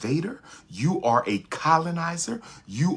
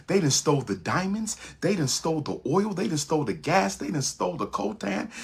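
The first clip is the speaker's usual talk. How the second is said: ordinary talk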